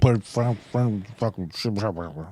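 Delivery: very low, trailing mumble; mock grumble sinking into near-silent muttering; grumbling old boss.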